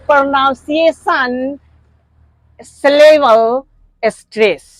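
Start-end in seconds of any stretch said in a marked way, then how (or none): 0.1-1.6 प्रोनाउंसिएशन drawn out slowly, rising at the end; demo word said slowly. Shown as a demonstration. Teacher to students, a slow careful demo word. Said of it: "आइए हम सिखाते हैं आपको".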